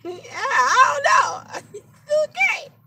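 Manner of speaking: high, slow laughing through the words; high laughing shrug. Real, not acted. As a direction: laugh it out, giving up. Laughing after the story.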